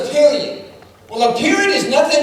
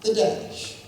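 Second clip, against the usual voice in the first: unvoiced soft announcement; almost breathe the words like a secret; quiet dash; 9 dB quieter than usual. Shown as a demonstration. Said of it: "this might work".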